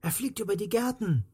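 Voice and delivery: put-on high-pitched excited child's call. A narrator's reading. Child tracking the flying ladybird for friends, excited high call. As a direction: report it eagerly to the group. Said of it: "rief eines von ihnen".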